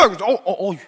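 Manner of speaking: mock-startled stammer; flustered. Peter shocked at his own blow, stammering flustered. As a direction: falter in acted dismay. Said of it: "자기도 당황한 거예요".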